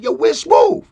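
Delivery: high, loud call-out; imitated eager call. Rapper calling out for Smooth at the limo, imitated eager call. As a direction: call out loudly as if looking for someone. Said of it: "Like that".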